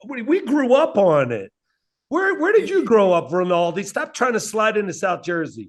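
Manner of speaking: high, indignant hometown defense; offended. Real, not acted. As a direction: challenge him like an insulted local. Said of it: "look how [name] got offended".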